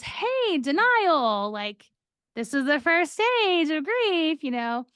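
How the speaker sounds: high-pitched cheery counselor bursting in; chipper counselor. Retold or imitated. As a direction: burst in cheerfully with unwanted advice.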